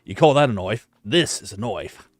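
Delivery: hammed-up Australian accent, slowing on the comeback; mock Australian one-upmanship; Aussie accent. Shown as a demonstration. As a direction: do a stock movie Aussie. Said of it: "a smidge of a Aussie accent".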